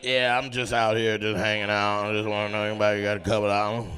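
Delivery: low, parched mimicry; imitated croaky low panhandler; dry-throated voice. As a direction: talk as if your throat needs wetting. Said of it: "sound like you haven't had a beverage".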